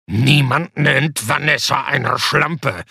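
slow, heavy giant voice; menacing giant. A narrator's reading. Giant warning Sebastian about Vanessa, slow and heavy. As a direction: deliver it like a final warning. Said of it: "sagte der Riese".

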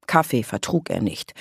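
plain reading voice

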